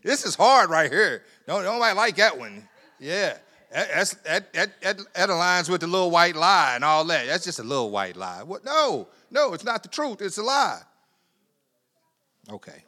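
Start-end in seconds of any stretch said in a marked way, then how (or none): 0.1-10.8 loud, driving sermon climax; preaching at full force. Acted.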